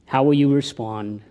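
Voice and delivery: ordinary talk, nothing marked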